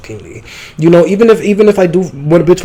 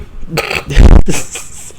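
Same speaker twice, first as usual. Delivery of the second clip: breathy laugh; 4 dB louder than usual. Real, not acted. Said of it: "I'm so fucking stupid".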